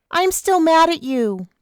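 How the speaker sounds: put-on high, pouting young woman; sulky Vanessa. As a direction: pout it out, high and hurt. Vanessa sulking behind door.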